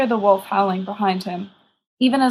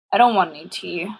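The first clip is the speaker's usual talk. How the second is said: ordinary delivery, nothing marked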